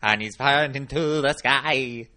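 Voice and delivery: slow, loud wavering rock-star croon; throaty wavering Bowie croon; [name]. Retold or imitated. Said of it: "[name] thing".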